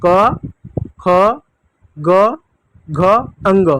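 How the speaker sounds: each letter spaced and clear; teacher leading the alphabet chant; letter drill. Shown as a demonstration. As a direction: recite for children to repeat. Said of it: "एक बार मेरे साथ पढ़िएगा".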